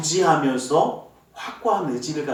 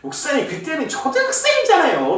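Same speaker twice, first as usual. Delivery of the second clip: much higher, imitating a young man laughing it off; grown child laughing; 10 semitones higher than usual. Grown child laughingly answers the pastor's teasing question. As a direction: put on the grown-up child's amused voice. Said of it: "막 웃으면서 얘기하더라고요".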